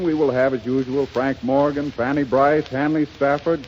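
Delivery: ordinary talk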